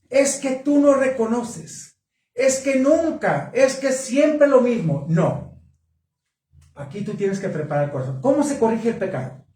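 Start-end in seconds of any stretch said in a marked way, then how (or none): 0.1-5.1 higher, slow, nagging reproach; accusing spouse. Retold or imitated. Spouse arriving with sword drawn, mock accusing. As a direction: mimic a spouse launching blame, drawn out. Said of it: "nunca llegues con la espada desenvainada".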